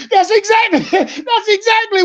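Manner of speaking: high, laughing outburst; laughing through the words, pitched high; real laughing disbelief. Real, not acted. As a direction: crack up while insisting it's true. Cracks up at the retold answer.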